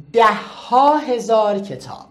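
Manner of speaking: ده ها هزار کتاب said slowly; slow model answer. Shown as a demonstration. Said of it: "به من بگی". (آرام و شمرده)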